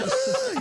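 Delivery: high imitated straining noise; mock gasp under a falling weight; bracing gasp. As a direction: brace with a high, startled grunt. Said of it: "And she went".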